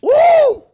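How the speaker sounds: a high, loud whoop of excitement; hype whoop. Real, not acted. Streamer whoops to chat about lifting, high and loud. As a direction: let out a big pumped-up woo.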